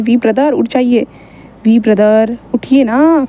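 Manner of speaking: slow, drawn-out coaxing; coaxing wake-up call. Retold or imitated. Friend slowly coaxing sleeping Wing awake after class. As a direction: stretch each word to rouse a sleeper.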